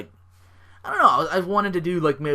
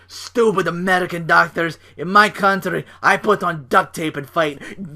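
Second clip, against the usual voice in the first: loud, higher mock Russian accent; Rusev; 5 semitones higher, 7 dB louder than usual. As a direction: rant like an indignant Russian strongman. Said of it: "Rusev's tweeting his displeasure. He's just like".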